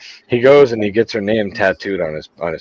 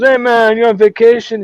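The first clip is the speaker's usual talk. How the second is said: put-on high, loud Jamaican accent; Jamaican guy; 10 semitones higher, 5 dB louder than usual. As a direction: play up a friendly Jamaican greeting. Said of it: "this Jamaican guy comes walking".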